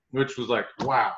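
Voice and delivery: ordinary delivery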